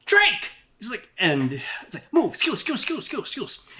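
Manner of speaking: put-on high, cracked old man calling out; an old wood elf calling Drake from the back, high and reedy. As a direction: shout across the room like a frail old elf. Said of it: "a voice from the back says".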